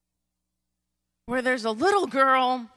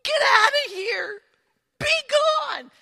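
high, tearful mock command; anguished little girl; 7 semitones higher, 3 dB louder, slower than usual. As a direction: drive it away while acting heartbroken. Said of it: "that little girl has to, like".

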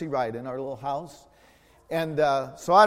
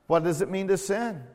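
ordinary talk

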